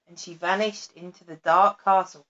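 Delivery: plain reading voice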